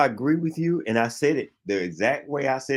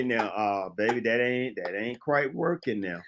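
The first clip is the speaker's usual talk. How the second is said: softer and slow, easing the bad news to a partner; gentle honest letdown; 5 semitones lower, 8 dB quieter, slower than usual. Man gently telling his partner her outfit isn't working, soft and slow. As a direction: let her down easy, quiet and careful. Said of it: "you cannot look at her and say".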